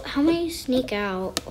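ordinary delivery

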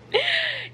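high squealing giggle; real giddy laugh; excited squeal of laughter. Real, not acted. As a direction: squeal with delight. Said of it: "I'm so excited".

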